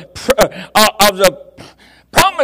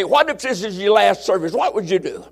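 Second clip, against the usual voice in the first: normal talking voice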